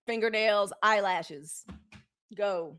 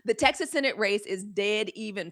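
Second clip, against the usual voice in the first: ordinary talk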